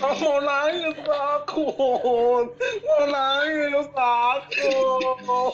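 high-pitched crying laughter; laughing so hard it sounds like sobbing; laughing to tears. Real, not acted. Group laughing hysterically after thunder.

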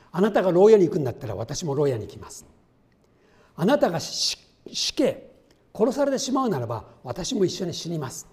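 fervent vow. Acted. Peter's fervent vow, paraphrased.

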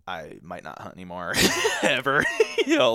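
words breaking into a laugh; laughing through it. Real, not acted. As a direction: laugh at your own misery. Laughing at his own despair.

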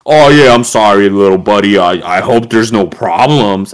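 loud, over-eager fake apology; hammed-up backpedalling to a kid; mock apologetic cop. Cop's sudden apology acted out.

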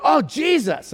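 high, delighted recognition; lit up. Retold or imitated. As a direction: exclaim it high, as if lighting up. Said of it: "like, lit up".

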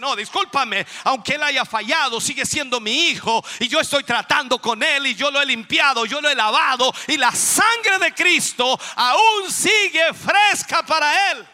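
put-on impassioned shout for his child; shouted divine defense. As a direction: cry out the Spirit's defense. Holy Spirit voiced defending believer.

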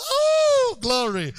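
sudden high cry of glory, then shouting; ecstatic outburst. Real, not acted. Preacher to congregation, bursting out in joyful shouted praise.

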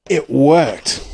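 usual speaking voice

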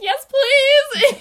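high, loud, wailing plea; imitating oneself mid-cry; mock tearful wail. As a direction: blurt it out as if bawling. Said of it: "I just started bawling".

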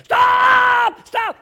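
high, drawn-out, very loud yell; imitated wild-man scream. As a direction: scream to flag down a driver, acting it out. Wild man jumping at the car.

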